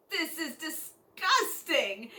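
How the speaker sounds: revolted. Acted. Finding the old bag years later, acted disgust. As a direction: stretch it out in hammed-up revulsion.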